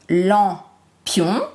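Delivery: "lampion" slowly in two beats, lam, pion; split into syllables. Shown as a demonstration. Teacher isolating the pion syllable, "lampion" syllable by syllable. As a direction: leave a clear gap after "lam" in "lampion". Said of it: "le son I dans pion".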